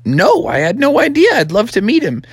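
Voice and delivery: high, eager little-frog voice; high-pitched storybook son; young frog son. Acted. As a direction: pipe up as a keen kid. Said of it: "And Froggy's like".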